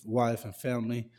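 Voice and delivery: usual speaking voice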